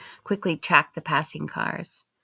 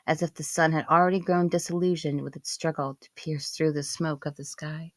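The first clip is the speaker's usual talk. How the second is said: ordinary delivery, nothing marked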